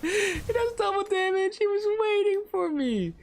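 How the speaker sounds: real laughing disbelief. Real, not acted. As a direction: squeal in outraged disbelief.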